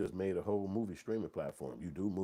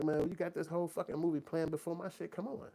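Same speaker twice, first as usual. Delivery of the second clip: angry complaint; 10 semitones higher than usual. Retold or imitated. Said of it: "I'm like".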